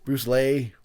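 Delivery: "Lee" said to rhyme with "lay"; altered last name. Shown as a demonstration. Listing variations of the last name, "Lee" turned into "Lay". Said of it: "any variation you can think of".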